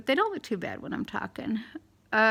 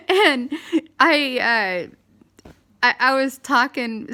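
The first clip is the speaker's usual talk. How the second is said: laughing; 4 semitones higher, 6 dB louder than usual. Real, not acted. Laughing at the memory.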